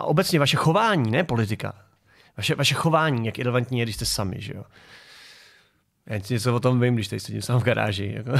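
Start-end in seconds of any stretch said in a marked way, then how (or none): none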